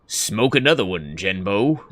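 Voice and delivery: low, soft deep-voiced tease. Teasing girlfriend coughing in the bathroom, low deep voice. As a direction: rumble it low like a joke. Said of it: "and I was like".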